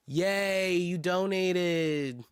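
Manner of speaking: slow singsong sarcasm; sarcastic cheer. Pretend. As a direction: stretch it into an unimpressed "yay". Mock cheer after "who cares.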